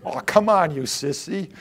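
dismissive dentist mocking a wincing patient. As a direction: tease the patient as a sissy. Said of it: "There are others that go".

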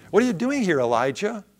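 high, loud challenge dropping on the name; confronting question. Acted. God asks Elijah why he is in the cave, urgent confrontation. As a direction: challenge him, pitched well up. God's question re-voiced with force.

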